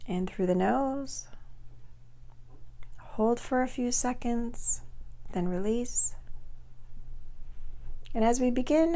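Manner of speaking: soft, unhurried meditation voice with long pauses; guided meditation. Acted. Coach guiding listeners to release depression, soft guided-meditation voice.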